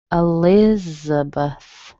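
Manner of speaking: Elizabeth drawn out as uh-LIZ-uh-buth; second-syllable stress. Shown as a demonstration. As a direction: slowly lean on LIZ in Elizabeth, other syllables weak. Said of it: "It has three weak syllables".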